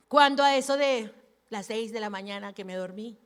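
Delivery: normal talking voice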